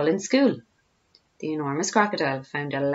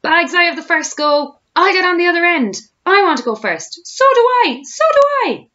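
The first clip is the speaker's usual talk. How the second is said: put-on high, loud clamouring children; shouting with excitement; 12 semitones higher, 7 dB louder than usual. A narrator's reading. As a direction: squabble eagerly for the first turn. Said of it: "shouting with excitement".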